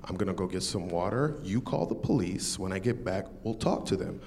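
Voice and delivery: low, measured retelling of a calm request. Retold or imitated. Carjacking victim quietly asks cashier to call police, very calm. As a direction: keep it quiet and level, nothing alarmed. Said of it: "very calmly to the cashier".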